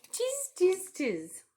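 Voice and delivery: very high, loud mocking tiz; monkey chatter. Monkeys stamping back, high loud mocking. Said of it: "the monkeys ... said".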